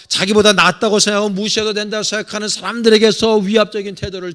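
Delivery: loud, rousing pulpit delivery; full-force preaching. Acted. Pastor exhorting his congregation, loud and rousing. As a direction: preach it out to the pews.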